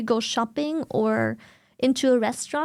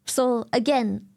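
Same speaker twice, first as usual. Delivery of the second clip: ordinary delivery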